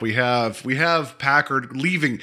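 ordinary delivery

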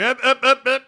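loud, high mock scolding; emphatic up up up. Grandmother cutting Letty off, loud mock scolding. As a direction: shut him down loudly, comically. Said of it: "And she's like".

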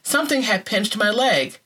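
ordinary delivery, nothing marked

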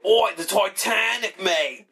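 loud, high, drawn-out mock Australian; badly mixed Boston-English-Australian accent; muddled Australian accent. Ribbing the Australian Titanic builder, bad mock Australian accent. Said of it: "like a Boston, English, Australian, all mixed in one".

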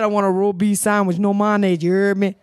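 low, garbled order in imitated Creole-like accent; thick Creole-like accent. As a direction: slur the words together in a thick Creole-like accent. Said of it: "Is this some Creole shit".

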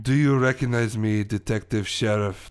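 theatrical French villain; French-accented villain revealing himself; crazy French character. Acted. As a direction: confront an old foe in a French accent. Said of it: "who is this crazy French character".

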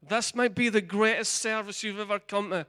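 rising appeal. Acted. Preacher inviting the congregation to hear the gospel, building. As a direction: lift the invitation to a climax.

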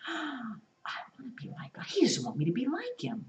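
gasp, then a breathless whisper; gasped, wide-eyed whisper; awed whisper. Acted. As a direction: whisper like a tempting secret. Eve tempted, gasping.

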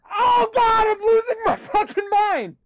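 high, loud cry of mock despair; hammed-up meltdown. Playing Mel Gibson breaking down for cohosts, wailing shout. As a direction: wail it like a man losing it. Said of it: "I think you had too many pills".

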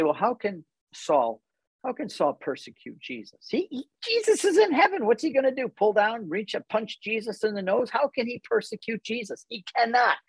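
high, incredulous, mocking the idea; skeptical questioning. In the movie, arguing with Janet, scoffing disbelief. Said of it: "And in the movie, I say".

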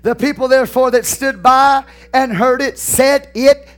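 high, ringing scripture proclamation; preaching. Acted. Pastor reading John twelve to his congregation, forceful preaching. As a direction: proclaim the verse slowly, driving the key words hard.

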